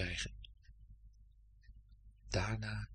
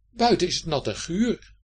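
louder, higher grumbled explanation; grumbling into beard; 6 semitones higher, 5 dB louder than usual. A narrator's reading. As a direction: grumble a complaint into your beard. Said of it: "in zijn ijspegelachtige baard brommend". (in je baard brommend)

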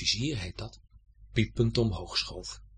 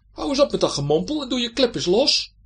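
high, loud scolding; bold shouted order; 13 semitones higher, 9 dB louder, faster than usual. A narrator's reading. Gerrit orders the armour to open up, high loud shout. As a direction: call out a cheeky command.

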